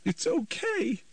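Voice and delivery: high, cracking motherly plea. A narrator's reading. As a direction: let the voice break as she soothes. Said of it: "her voice cracking".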